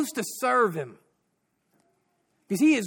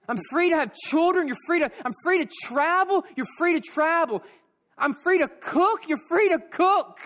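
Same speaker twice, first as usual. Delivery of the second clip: higher and higher with each "free"; rising excitement; 7 semitones higher than usual. Acted. Preacher voicing the freed girl and the president, mounting joy. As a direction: let the joy build line by line. Joyful back-and-forth about freedom.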